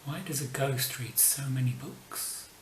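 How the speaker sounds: usual speaking voice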